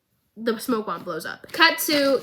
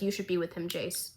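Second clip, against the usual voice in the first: soft earnest line; 5 semitones lower, 11 dB quieter than usual. Retold or imitated.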